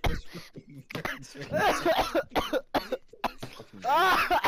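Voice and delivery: high, slow mock whisper-shout; drawn out high-pitched panic; hiding knight voice. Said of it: "and it's like".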